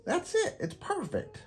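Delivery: very high kitten cry of joy; Kitty thrilled at her painting; excited exclaim. Acted. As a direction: burst out with delight. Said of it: "exclaims Kitty".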